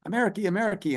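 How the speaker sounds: high childlike call, lightly imitated; little girl calling. A little girl tugging a soldier's leg, lightly imitated high childlike call. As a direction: pipe it up like a small girl pleading for attention.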